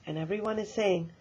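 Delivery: plain reading voice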